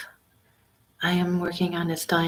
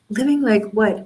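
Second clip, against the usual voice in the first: indignant; 5 semitones higher, 6 dB louder than usual. Retold or imitated. Retold indignant reply.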